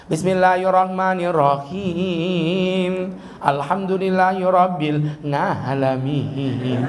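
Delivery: slow recitation in a Javanese lilt; slow Quran line with a Javanese melody; Javanese-style recitation. Retold or imitated. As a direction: chant it the way a Javanese imam would, slowly. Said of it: "Orang Jawa kan begitu lagunya".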